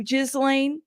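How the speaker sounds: Ghislaine drawn out as "JIZ-lain"; trolling mispronunciation. Shown as a demonstration. Host thanking a viewer who prefers her Ghislaine, JIZ-lain. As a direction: say Ghislaine with a Z in the middle, rising. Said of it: "prefer the way I say it".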